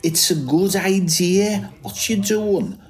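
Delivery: a lightly voiced high mate, pushy; high-pitched mate. Mate nagging Cal about his podcast, high put-on voice. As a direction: nag him in a high put-on voice.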